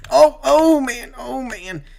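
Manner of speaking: real shock at a near crash; alarmed outburst. Real, not acted. Near wreck on restart.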